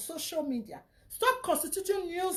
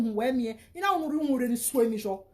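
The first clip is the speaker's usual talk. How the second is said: ordinary talk, nothing marked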